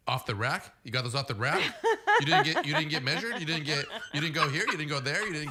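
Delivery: mock-incredulous teammate. Golfer ribbing his teammate over new gloves, mock-incredulous. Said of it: "could just tell he was so pissed".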